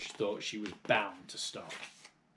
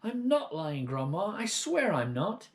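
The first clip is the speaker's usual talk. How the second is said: put-on pleading boy; 8 semitones higher than usual. George pleading.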